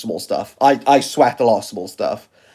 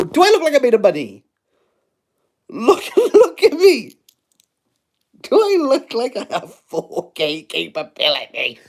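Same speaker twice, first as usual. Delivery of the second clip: mock indignant; 10 semitones higher than usual.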